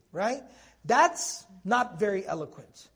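ordinary delivery

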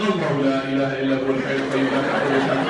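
fast, loud auctioneer rattle. Retold or imitated. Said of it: "I sound like an auctioneer".

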